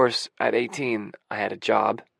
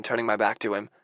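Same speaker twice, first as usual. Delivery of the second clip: plain reading voice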